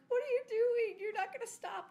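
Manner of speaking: high, loud cry of protest; horrified child. Retold or imitated. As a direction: voice the kids' shocked objection.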